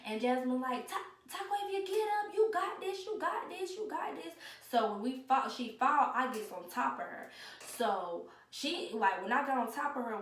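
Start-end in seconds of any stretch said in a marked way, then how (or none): 0.9-4.3 cheering friend. Retold or imitated. Friend cheering her on mid-fight.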